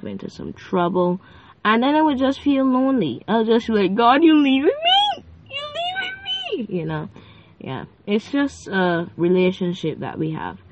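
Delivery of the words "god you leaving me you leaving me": high pleading whine at being left alone; whiny plea. Retold or imitated. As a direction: whine like a left-behind child. Whining to God.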